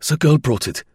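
German junior officer reporting; lieutenant explaining. Acted. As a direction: explain hastily to a superior. Lieutenant explaining to angry general.

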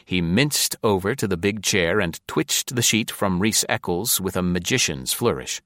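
ordinary delivery, nothing marked

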